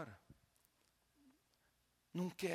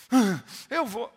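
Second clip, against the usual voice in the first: much louder, higher demon cry; demon yell; 10 semitones higher, 17 dB louder, much slower than usual. Retold or imitated. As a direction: roar it as the demon. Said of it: "Aí ele falou assim".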